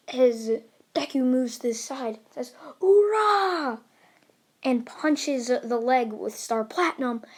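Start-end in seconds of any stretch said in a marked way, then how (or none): 2.8-3.8 loud, high, drawn-out yell; battle cry. Acted. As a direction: shout it high as you throw the punch. Hero's battle cry while punching.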